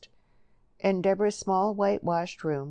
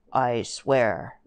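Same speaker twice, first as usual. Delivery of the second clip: low, louder solemn oath; 5 semitones lower, 6 dB louder than usual. A narrator's reading. Eli gives Joe his oath, solemn. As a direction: swear it gravely, in a lowered voice. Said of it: "said Eli solemnly".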